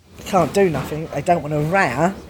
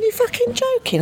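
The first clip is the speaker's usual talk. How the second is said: high, shocked disbelief; incredulous. Retold or imitated. Woman refusing to smuggle package, high incredulous squeak.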